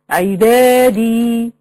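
عبادي with aa and final ee held long; drawn-out long vowels. Shown as a demonstration. Showing the ya madd to students, vowels lengthened. Example word with prolonged madd.

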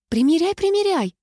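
put-on high, lilting girlish call; calling back. A narrator's reading. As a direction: sing out encouragement from outside the booth. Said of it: "откликнулась Светка".

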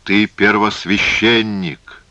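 slow, emphatic reminder; insistent. Acted. Phasael reminds the priest of his rank, insistent. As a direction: drive the title home, drawing it out. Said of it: "настаивал Фазаэль".

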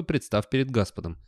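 plain reading voice

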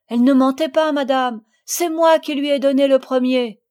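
loud, high, earnest owning-up; urgent confession. A narrator's reading. Camille confesses to madame Ficini, urgent and loud. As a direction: speak up firmly to clear her. Camille owns up in Sophie's defence.

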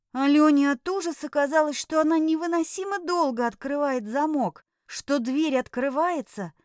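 ordinary delivery, nothing marked

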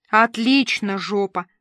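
usual speaking voice

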